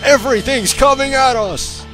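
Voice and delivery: high, excited play-by-play cry; hyped commentary. Pretend. Calling out enemies in intro.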